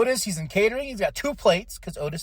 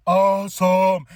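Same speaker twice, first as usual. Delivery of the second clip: loud echo; 6 dB louder, slower than usual. Real, not acted. Echoing awesome loudly.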